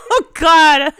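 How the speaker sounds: real amused reaction; laughing. Real, not acted. Listener cracking up at story.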